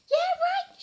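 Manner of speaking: very high, loud mock disbelief; exclaimed. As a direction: throw it back loud. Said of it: "she exclaimed".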